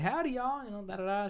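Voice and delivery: high, twangy Southern country greeting; country accent. Retold or imitated. Host mimicking a country woman's American Southern accent greeting. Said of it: "like, a country accent".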